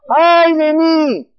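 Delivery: loud, high, slow lament; a preacher's drawn-out wail; anguished cry. Acted. Preacher crying out Paul's words.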